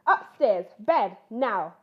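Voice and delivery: loud stern bear roar. Acted. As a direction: roar the cubs off to bed. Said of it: "roared Mister Bear".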